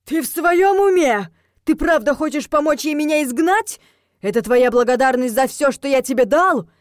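loud, high, indignant shouting; scandalised, shrieking reproach; outraged father. Acted. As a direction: berate your son in disbelief. Stunned father berating his son.

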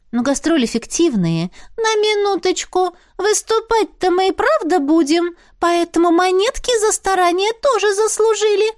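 high, squeaky little spirit, businesslike. Acted. Spirit talking business with the heroine, high squeaky voice. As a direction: pipe it up like a small sprite.

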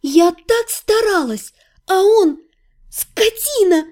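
обиженный крик; hurt outburst. A narrator's reading. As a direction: wail it out in wounded fury. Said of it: "закричала я от избытка чувств".

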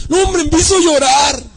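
high, loud hammed-up wail; mock overcome churchgoer. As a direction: exaggerate being moved to tears. Mimicking overcome church member.

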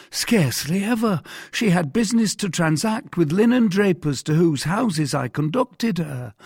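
high-pitched voice of an eager-to-explain husband; high, earnest character voice; deferential mercer. Acted. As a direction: answer the interrogator dutifully, pitched well up. Voices Bonacieux answering Cardinal.

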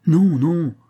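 high, loud double no to stop him leaving; urgent cry. A narrator's reading. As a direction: call out to hold him back, loud and high. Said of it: "s'écria Holmes".

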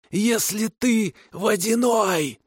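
high, slow terrified hiss; hissing kikimora. A narrator's reading. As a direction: hiss it out in primal terror. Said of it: "прошипела Тамара Кикимора".

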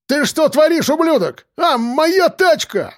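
very high, strained outraged wail; rage, then wail in dismay; outraged cursing. A narrator's reading. Driver yelling over his damaged car, outraged wail. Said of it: "выругался Белобрысый".

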